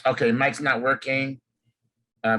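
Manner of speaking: normal talking voice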